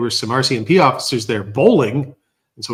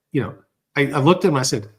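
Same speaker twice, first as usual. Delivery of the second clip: normal talking voice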